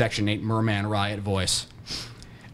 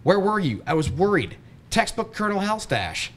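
yell back; 8 semitones higher than usual. A narrator's reading. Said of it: "I yell back".

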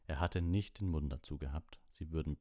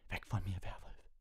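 slightly breathless, higher-pitched warning; gasped; 4 semitones higher than usual. A narrator's reading. Injured Ron warding off Lupin, gasping. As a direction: gasp it out through pain. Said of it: "doch Ron japste".

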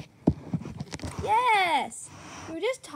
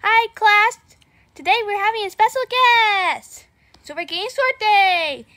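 loud cheerful teacher; 10 dB louder than usual. Acted. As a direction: announce it to the class. Plays a teacher addressing class.

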